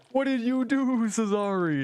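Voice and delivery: high panicked wail, slow on fire line; mock panic. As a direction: shriek it like a hammed-up disaster victim. Mock panic over burning house.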